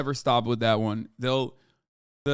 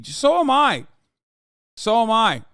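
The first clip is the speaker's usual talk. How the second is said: shouted high and loud, twice; loud exclamation; 8 semitones higher, 6 dB louder, slower than usual. Real, not acted. Streamer admits he peddles brain rot too, loud exclamation. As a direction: blurt it out at full volume.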